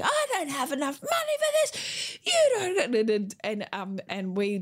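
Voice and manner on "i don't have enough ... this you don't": very high mock yell without real volume; imitated screaming mum. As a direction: rant shrilly like an exasperated mum, hammed up. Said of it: "listened to her mum scream".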